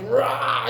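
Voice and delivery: high mock fighting yell; high-pitched "rah" attack cry; imitated battle roar. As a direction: act out a roar as you lunge.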